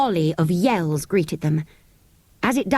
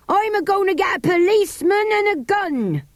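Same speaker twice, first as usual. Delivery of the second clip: calling up; 13 semitones higher than usual. Acted. Andrew calling up.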